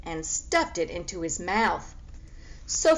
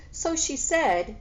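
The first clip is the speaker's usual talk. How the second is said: ordinary delivery, nothing marked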